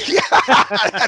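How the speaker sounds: a yes that bursts out in a laugh. Real, not acted. Laughing at his friend's quip about the check, laughing yes. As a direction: laugh right through the word.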